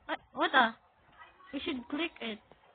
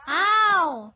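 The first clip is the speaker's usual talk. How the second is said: loud, drawn cry of pain; pained yelp; 8 dB louder than usual. Real, not acted. Player hurt in a game, pained yelp. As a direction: yell out as if hurt.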